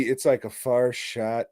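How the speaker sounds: usual speaking voice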